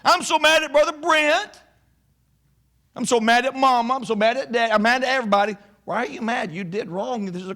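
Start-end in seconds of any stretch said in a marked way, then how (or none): 0.0-5.5 mock a pouting teen running to mama; whiny mad kid. Teen complaining to mama about the school, in a higher sulky whine. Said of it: "don't run to your mama".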